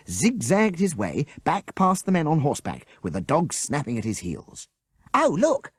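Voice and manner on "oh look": high, bright delighted call; delighted cry. A narrator's reading. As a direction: point it out with glee. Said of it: "cried Avril in delight".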